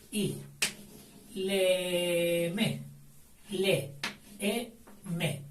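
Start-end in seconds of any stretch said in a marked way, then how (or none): 1.3-5.4 Léeme broken into Le, e, me; syllables split. Shown as a demonstration. Teacher splitting Léeme into syllables. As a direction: separate each syllable of Léeme. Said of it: "Fuerte. Esdrújula, acento".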